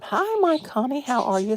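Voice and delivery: high, loud happy hello; bright squeal of welcome to a friend; excited greeting. Real, not acted. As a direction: greet a dear friend with delight. Greeting a viewer warmly.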